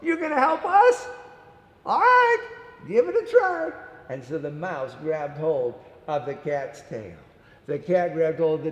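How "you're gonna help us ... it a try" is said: high, loud teasing doubt; amused disbelief. Acted. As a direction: scoff kindly at the tiny helper. Characters doubt the mouse.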